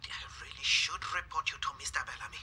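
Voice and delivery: fast, hushed reproach; whispered scolding. Acted. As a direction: scold her under your breath. Matron scolds nurse under breath.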